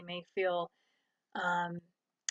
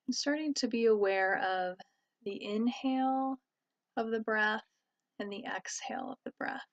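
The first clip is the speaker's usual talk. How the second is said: calm, even voice with long pauses; guided meditation. Real, not acted. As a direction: guide listeners gently inward, leaving space between phrases. Leads a meditation.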